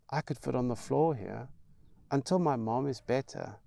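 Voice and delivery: a hint of a timid child begging to stay; little boy pleading. Mikey asking Big Jan for a place to sleep, timid plea.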